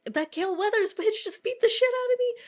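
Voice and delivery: high-pitched, whiny mock outrage; outraged complaint. Randy limping up to complain about Gale, high indignant voice. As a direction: squeal the grievance in disbelief. Retold complaint of beaten man.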